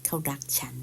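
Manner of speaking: whispered example phrase; soft whispered repeat for learners. Shown as a demonstration. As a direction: hush it like a secret. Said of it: "teacher repeats example whispered".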